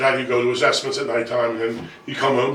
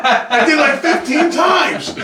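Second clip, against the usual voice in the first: very high, loud protest; slow, hammed-up indignant comeback; retold exasperated shout; 5 dB louder, much slower than usual. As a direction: shout the punchline in mock exasperation. Exasperated answer at punchline.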